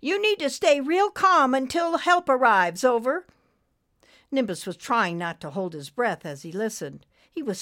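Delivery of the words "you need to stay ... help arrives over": high, loud, urgent nurse voice; radio nurse. A narrator's reading. Nurse keeps the trucker steady until help comes, high and urgent. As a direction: project up high over the radio. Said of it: "Betty's voice cracked over the radio".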